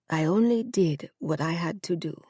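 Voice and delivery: soft, lightly voiced elderly mother; a hint of a proud dying mother, quiet; frail mother. To her son at her deathbed, softly.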